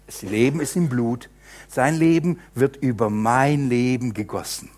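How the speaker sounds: ordinary talk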